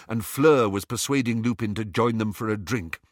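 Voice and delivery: plain reading voice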